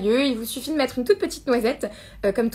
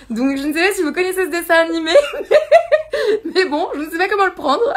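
talks through giggles, amused and embarrassed; sheepish laughing chatter; laughing while talking; 5 semitones higher, 6 dB louder than usual. Real, not acted. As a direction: laugh the words out, high and bubbly. Teased about looking like Maui.

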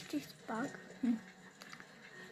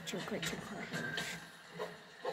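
whispering; 7 semitones lower, 16 dB quieter than usual. Real, not acted. Whispers his real surname again.

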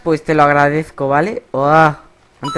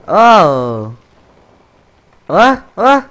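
startled; 7 dB louder, much slower than usual. Real, not acted. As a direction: jump at the fright. Startled by a jump scare.

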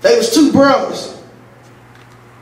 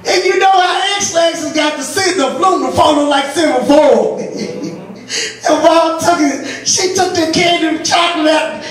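ordinary talk

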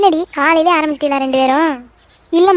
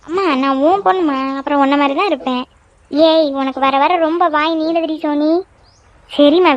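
ordinary talk

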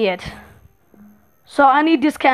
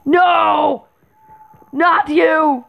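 higher-pitched protesting shout; dismayed cry; 4 semitones higher, much slower than usual. Real, not acted. Player seeing an unwelcome enemy, dismayed.

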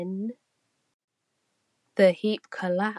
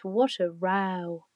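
usual speaking voice

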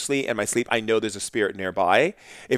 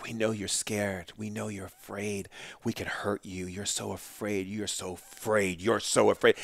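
menacing spirit voice; 2 semitones lower, 9 dB quieter than usual. Retold or imitated. Spirit taunting the frightened shaman, hushed and menacing. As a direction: hiss the taunts like a circling spirit.

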